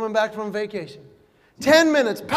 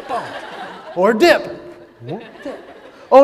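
laughing bump; 6 dB louder, much slower than usual. Shown as a demonstration.

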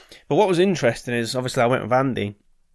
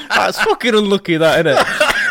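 laughing; 8 semitones higher, 7 dB louder than usual. Real, not acted. Laughing at the psychic's blunder.

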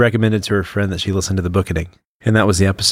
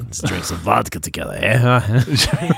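put-on Russian accent. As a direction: invite a comrade to drink in mock Russian. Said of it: "Russian [name]".

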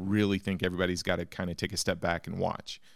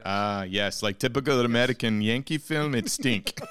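broken English in a put-on Russian accent; mock Russian accent. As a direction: play a cartoon Russian host mocking Yankees.

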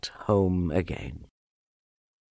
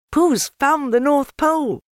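put-on high, bright little boy voice; high, eager child's voice; young Christopher Robin; 6 dB louder than usual. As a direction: lift it up like a kind small boy. Christopher Robin announces proudly.

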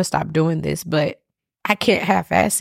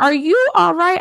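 worried videographer; 12 semitones higher, 4 dB louder than usual. Retold or imitated. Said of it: "And he was like".

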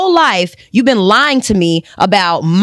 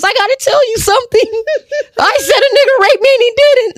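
much higher, hammed-up confession of a dying old lady; mock confessing mother; 13 semitones higher than usual. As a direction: ham up the guilty mother, high and shrill-pitched with a giggle. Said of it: "she probably done already told the C N As".

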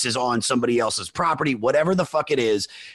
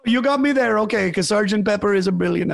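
put-on Canadian accent; 10 semitones higher, 4 dB louder than usual. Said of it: "my Canadian accent's not very good".

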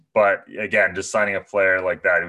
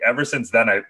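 ordinary delivery

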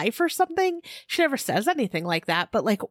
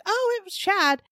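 high airy dismissive tone; breezy blame-shifting; 11 semitones higher than usual. Retold or imitated. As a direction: brush it off, point at Chad. Imitating Julie shifting blame.

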